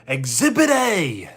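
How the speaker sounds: high, loud, nasal-free cartoonish man-child exclamation; Pee Wee Herman. Retelling a story to chat, a loud Pee Wee yelp. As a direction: bark it out like a gleeful kid-man. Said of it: "Pee Wee Herman was just one of a kind".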